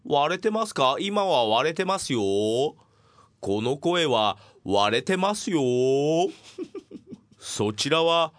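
slow, stretched vowels; drawn-out sound check. Shown as a demonstration. Host asking listeners about distortion, slow drawn-out test. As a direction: call it out slowly, holding each ending. Testing whether voice distorts on air.

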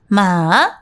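まあ dipping low then rising like Chinese third tone. Shown as a demonstration. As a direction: dip まあ down then up. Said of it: "まあって言うじゃん？ 中国の発音。".

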